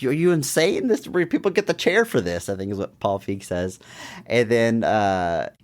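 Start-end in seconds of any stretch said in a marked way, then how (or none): 0.0-2.5 counselors gasping in disbelief, higher; shocked camp counselors, pitched up; mock outrage. Counselors react to the kidnapping with mock outrage. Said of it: "the counselors are like".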